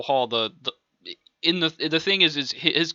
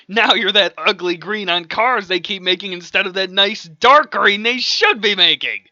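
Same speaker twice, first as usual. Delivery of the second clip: loud, raised, heated insult; angry rant; 6 semitones higher, 7 dB louder than usual. Acted. Mad man insulting his opponent, angry rant. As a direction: rant it, climbing louder toward the end.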